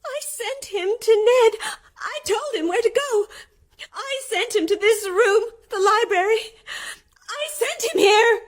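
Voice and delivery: high, breaking cries of horror; hysterical horror. Acted. Mary cries out that she sent the ghost to Ned. Horrified realisation, near hysteria.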